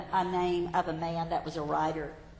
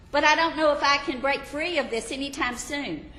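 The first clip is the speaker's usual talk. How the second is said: anxious confessor; 6 semitones higher, 5 dB louder than usual. Acted. Believer pleads.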